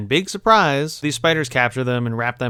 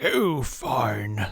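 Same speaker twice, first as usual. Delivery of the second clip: drawn-out wizardly grumble, a little softer; Gandalf; 5 dB quieter than usual. Retold or imitated. Wizard yielding to Bilbo's refusal, put-on grudging voice. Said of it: "Gandalf is like".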